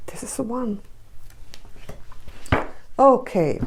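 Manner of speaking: ordinary talk, nothing marked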